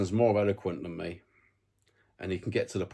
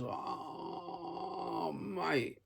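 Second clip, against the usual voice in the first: higher, softer, fed-up inner voice; exasperated inner voice; 9 semitones higher, 4 dB quieter, much slower than usual. Retold or imitated. He hears a fan say Savinio, inner voice groans, higher and softer. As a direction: sigh it out like your own brain scolding you. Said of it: "my brain just goes".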